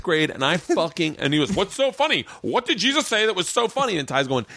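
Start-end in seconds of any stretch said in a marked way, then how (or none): 1.5-4.0 higher, mock-indignant; indignant teacher. Teacher catching a student laughing, higher and indignant. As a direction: demand it like an offended teacher.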